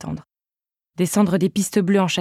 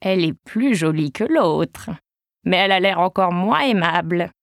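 put-on shaky old voice. A narrator's reading. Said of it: "une voix chevrotante".

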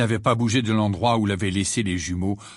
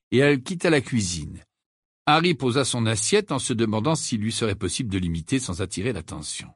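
ordinary delivery

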